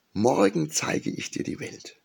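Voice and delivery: high, eager promising phoenix. A narrator's reading. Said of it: "versprach der Vogel".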